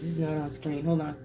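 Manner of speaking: normal talking voice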